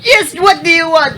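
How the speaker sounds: high, loud hammed-up Jamaican voice; mock Jamaican accent. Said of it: "do the Jamaican accent".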